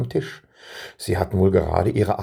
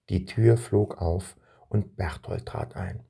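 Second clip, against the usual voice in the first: usual speaking voice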